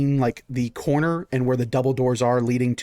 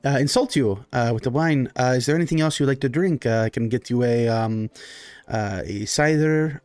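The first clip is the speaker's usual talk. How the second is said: halting, courteous Baron offering drinks; hesitant, accommodating host character; Baron, apologetic host. Acted. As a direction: play a solicitous noble host, searching for words.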